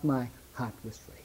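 breathed out in a whisper. Acted. Preacher whispering the hymn's last line to the congregation. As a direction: let the last line fall to a hush.

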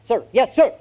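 high, barked recruit reply; drilled recruit. Acted. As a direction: snap back an instant answer to the sergeant. Recruit answers by reflex.

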